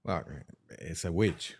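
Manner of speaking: ordinary talk, nothing marked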